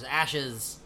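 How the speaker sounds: a creepy whispered-style ashes, lightly done; hint of a sinister cult leader saying ashes; lightly voiced eerie preacher; imitating Bray Wyatt. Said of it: "and he goes".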